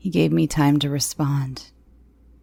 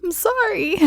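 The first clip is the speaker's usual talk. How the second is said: high, tearful pleading; choked, desperate apology; pleading sobs; 3 dB louder than usual. Acted. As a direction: beg through tears, voice up high. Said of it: "I pleaded behind sobs".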